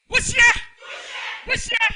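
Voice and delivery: high, loud shouted order; loud command. Real, not acted. Facilitator shouting commands at the group. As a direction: drive them to push. Directing group energy exercise.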